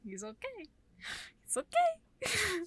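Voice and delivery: high, slow strained voice; hammed-up wheeze; strained mock reassurance. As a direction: gasp it out like a barely attached head. Joke about near beheading.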